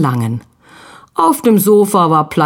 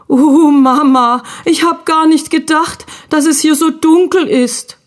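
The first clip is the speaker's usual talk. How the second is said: put-on frightened boy; 9 semitones higher than usual. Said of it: "schlotterten die Knie".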